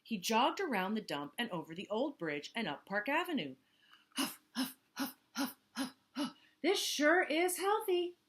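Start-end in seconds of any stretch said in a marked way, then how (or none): 4.2-8.2 winded huffing. Acted. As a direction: puff while still bragging. Hippo jogging, winded.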